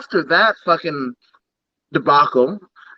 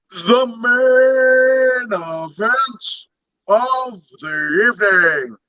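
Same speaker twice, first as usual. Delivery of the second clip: ring announcer; 6 semitones higher, much slower than usual. Pretend. Announcing the main event.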